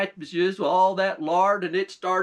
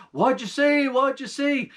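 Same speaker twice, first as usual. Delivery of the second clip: raised, higher calls from afar; mild hammed-up holler; 7 semitones higher than usual. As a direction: call out to a returning friend. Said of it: "they hollering out".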